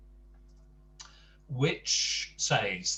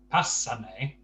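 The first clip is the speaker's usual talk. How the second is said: pásame with PA stressed, ending on a rise; stress on first A; 4 dB louder than usual. Shown as a demonstration. Teacher showing accent placement, pásame stressed on the first A. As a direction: lean on PA at the start of pásame. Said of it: "has the stress on the first A".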